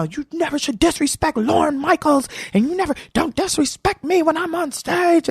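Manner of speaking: high-pitched, indignant scolding imitation; going off. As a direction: act out an angry telling-off. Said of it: "he was going off on me".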